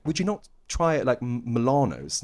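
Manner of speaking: Milano's with long AH in the middle; English pronunciation suggested. Retold or imitated. Client nudging voice actor to change, "Milano's" with long AH. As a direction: coax them towards "mih-LAH-nohs". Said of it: "she's like".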